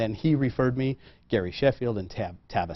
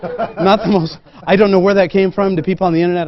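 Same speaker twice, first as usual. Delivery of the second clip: laughing; 6 semitones higher, 9 dB louder than usual. Real, not acted. Laughing at his own word.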